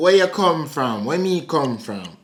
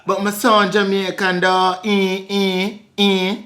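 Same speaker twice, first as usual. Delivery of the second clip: coaxing Jamaican patois; Jamaican accent. Pretend. Claiming to be Jamaican, in a Jamaican accent. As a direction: protest in a put-on Jamaican accent. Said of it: "You just did that accent".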